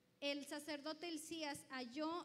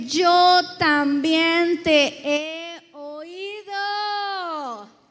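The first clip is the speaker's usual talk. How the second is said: very slow, much louder, drawn-out proclamation; voice of God; 27 dB louder, much slower than usual. Acted. Preacher voicing God to the believer, slow and grand. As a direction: pronounce it grandly as God speaking. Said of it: "Fíjense cómo dice".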